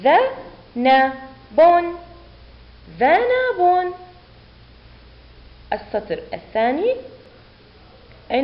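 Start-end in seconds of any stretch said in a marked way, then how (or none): 0.0-1.6 very slow with clear pauses; teacher's separated sounding-out; syllable by syllable. Shown as a demonstration. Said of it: "ونقرأ". 3.0-3.9 ذنب joined as one high word; teacher's clear, high whole-word model; blended word reading. Shown as a demonstration. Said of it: "ونقرأ".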